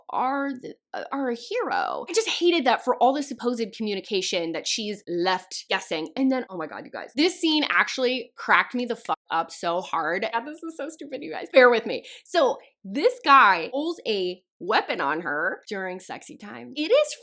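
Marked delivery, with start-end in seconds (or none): none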